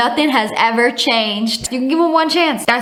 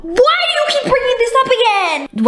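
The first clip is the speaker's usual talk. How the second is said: much higher, exasperated complaint; upset girlfriend; 12 semitones higher than usual. Acted. Girlfriend in acted quarrel.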